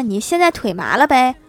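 ordinary talk

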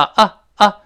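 short open 啊啊 without R; British vowel demo. Shown as a demonstration. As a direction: bark out two open 啊 vowels. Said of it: "英式发音的前面发啊啊".

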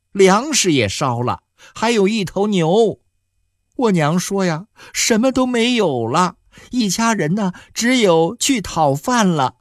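high tearful pleading, pitched well up; crying. Acted. Young wife weeping to her husband over the fire. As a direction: sob it out, rising in distress. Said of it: "白麦哭声更大了".

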